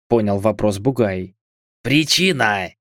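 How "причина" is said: put-on very old man. A narrator's reading. Old man's short question.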